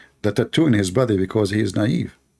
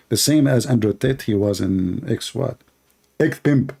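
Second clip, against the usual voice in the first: ordinary delivery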